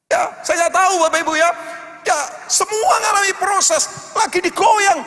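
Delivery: loud, fervent sermon over music; full-force preaching. Acted. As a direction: preach it out, pushing the congregation.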